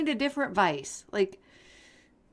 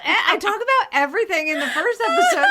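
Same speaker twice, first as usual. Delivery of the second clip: laughing; 9 semitones higher, 4 dB louder than usual. Real, not acted. Laughing excuse.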